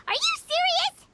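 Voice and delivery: high, loud disbelieving question; can't believe what he just heard; shocked disbelief. Acted. Shocked at possessed blade idea.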